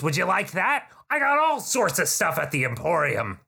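high, chummy goblin salesman; high, overfriendly goblin voice; eager goblin shopkeeper. Acted. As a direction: gush over the customer like a pushy shopkeeper.

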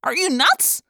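high, incredulous cry; high-pitched exclaimed question. A narrator's reading. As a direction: cry out in disbelief, voice jumping up. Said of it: "Marco cried".

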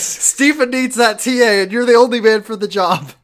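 high, slowed mock insistence; insistent shout. Cassidy insisting Mark is the man, insistent shout. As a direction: hammer it home loud and high. Acted reply continuing the yelled outburst.

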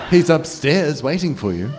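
high, sly nightmare taunt; creepy taunting voice. Acted. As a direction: tease her like a cruel phantom.

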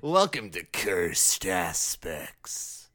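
slow, eerie announcer; slow spooky host voice. Acted. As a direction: drag it out ominously. Podcast intro echoed.

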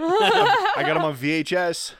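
high slow laughing voice. Real, not acted. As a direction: laugh through the brag. Laughing brag.

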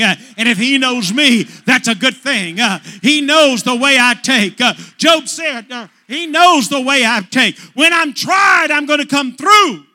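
loud, high, rhythmic preacher's shout; preaching at full force. Acted. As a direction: build the fire line by line. Preaching climax.

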